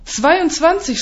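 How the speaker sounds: high-pitched, outraged echo; shouted disbelief. A narrator's reading. Narrator echoing a colleague's boast, incredulous cry. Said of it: "schreie ich".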